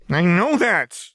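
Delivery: high, loud, slow snapping cry; frustrated outburst. A narrator's reading. Jinx snapping at Lux's patient advice, loud and high. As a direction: blurt it out in frustration.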